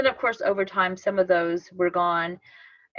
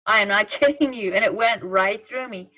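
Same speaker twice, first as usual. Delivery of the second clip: normal talking voice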